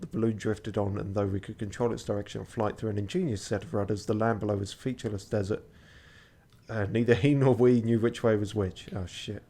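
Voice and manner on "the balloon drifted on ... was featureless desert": quiet, brisk story narration. A narrator's reading. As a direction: read it like a novel aloud. Story narration read aloud.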